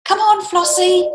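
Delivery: put-on high, soft boy's call; boy calling his lamb quietly near a dragon; boy calling softly. A narrator's reading. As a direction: coax the lamb gently in a boy's voice. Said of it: "the boy called softly".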